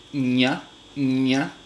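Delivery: "ña" with the tongue flat on the palate; palatal ña. Shown as a demonstration. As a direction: say "ña" as a clean palatal nasal. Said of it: "eh, palatal".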